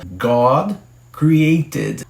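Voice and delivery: "God created" said slowly and clearly; slow English model. Shown as a demonstration. Teacher models the Bible phrase slowly for students.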